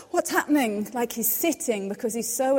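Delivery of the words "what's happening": higher, louder pretend panic; hammed-up startled question; mock alarm. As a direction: ask it like you're caught off guard. Mock panic of a standing God.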